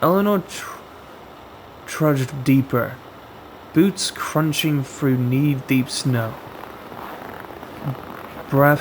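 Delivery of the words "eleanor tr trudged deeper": plain reading voice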